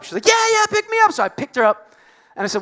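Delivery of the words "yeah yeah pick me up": high, loud excited mimicry of girlfriend; mock-excited girlfriend squeal; imitated eager yes. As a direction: jump at the offer in a put-on high voice. Girlfriend eagerly accepting, acted.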